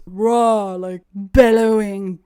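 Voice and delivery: loud, drawn-out bellow on the describing words; imitated bellowing. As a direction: lean into the words, mimicking a big bellow. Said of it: "deep baritone voice".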